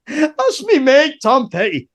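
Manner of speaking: put-on high-pitched, insistent Scottish accent; Scottish mate pleading his case, pitched well up; hard Scottish accent. Friend insisting to the guard, in hard Scottish accent. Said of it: "hard Scottish".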